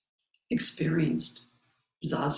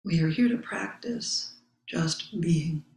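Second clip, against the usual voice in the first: calm mantra. Acted. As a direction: let each line settle like a meditation. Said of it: "my own mantra".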